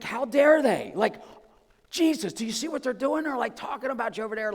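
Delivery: high-pitched, loud mock indignation; mock upset. Preacher voicing the twelve complaining to Jesus, mock upset. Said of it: "they got really upset".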